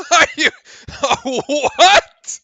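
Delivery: high, loud breaking into laughter; laughing disbelief. Real, not acted. Streamer laughing at a terrible call, disbelief. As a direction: laugh it out in shock.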